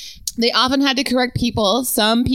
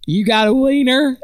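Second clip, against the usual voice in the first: put-on heavy Southern accent; 3 semitones higher, 5 dB louder than usual. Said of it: "in a heavy, heavy Southern accent".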